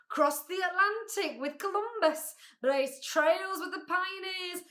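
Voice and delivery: grand, high boasting speech; pompous humbug, boastful. A narrator's reading. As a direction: orate proudly about the family line. Humbug boasts of his family.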